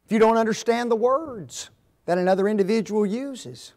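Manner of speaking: usual speaking voice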